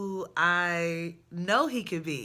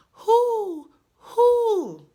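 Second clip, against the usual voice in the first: high, loud hooting like an owl; mock owl call; 12 semitones higher, 6 dB louder than usual. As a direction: hoot it out like the owl outside. Imitating the owl's hooting.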